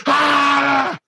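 sudden high scream of fright; startled scream. Retold or imitated. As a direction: yelp like a kid caught by a scary scene. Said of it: "And I was like".

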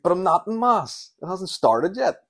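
high, loud indignant whine; protesting kid. Retold or imitated. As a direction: whine it like a wronged child. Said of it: "You're like".

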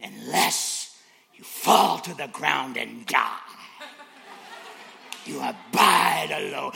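slow, loud doom-laden preaching; grave hellfire-preacher parody; mock solemn preacher. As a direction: intone it heavily, like a stern sermon. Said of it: "Next week".

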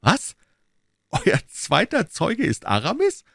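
high amazed cry. Acted. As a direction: disbelief rising further. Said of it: "in einem noch verwunderungsvolleren Ton".

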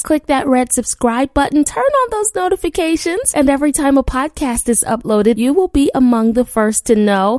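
ordinary talk

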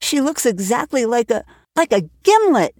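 put-on a child blurting an insult; indignant girl. Anne insulting Mrs Blewett, indignant and high.

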